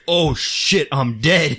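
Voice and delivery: high, loud sudden realization; as if just noticing he's dead; mock shock. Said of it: "he looks and goes".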